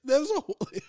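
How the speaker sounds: words squeezed out through laughter; laughing. Real, not acted. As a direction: laugh while trying to talk. Cracking up mid sentence.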